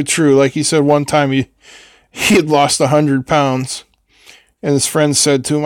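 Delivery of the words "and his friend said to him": ordinary talk, nothing marked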